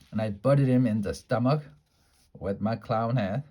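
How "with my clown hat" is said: plain reading voice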